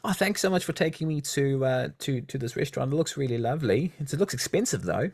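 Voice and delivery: a nervous date being polite; lightly voiced timid date; date girl voice. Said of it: "you're on a first date with a girl".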